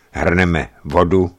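plain reading voice

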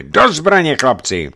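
loud rallying command; shouted call to arms. A narrator's reading. Sailor calls his men to arms, shouting loudly. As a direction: shout it to rouse the crew.

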